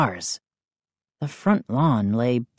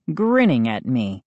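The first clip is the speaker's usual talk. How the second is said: ordinary delivery, nothing marked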